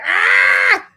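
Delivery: high, loud imitated scream; mock scream. Host imitates the contestant screaming over her scalp, high and loud. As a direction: yell like she's in agony. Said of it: "She was like".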